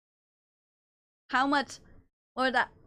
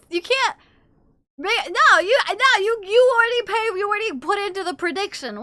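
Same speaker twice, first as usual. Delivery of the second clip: high, loud flustered protest; indignant protest; 7 semitones higher, 6 dB louder than usual. Real, not acted. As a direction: protest in a rush. Protesting chat.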